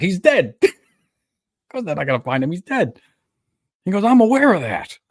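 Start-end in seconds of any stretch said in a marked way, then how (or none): 0.0-3.0 high mock exasperation; incredulous judge. Judge confronting the lawyer about a dead man, mock-exasperated and high. 4.0-5.0 high, loud mock protest; cornered lawyer. Lawyer cornered by the judge, high and loud mock insistence.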